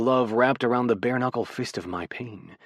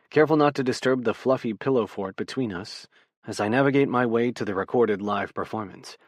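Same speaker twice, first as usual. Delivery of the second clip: ordinary delivery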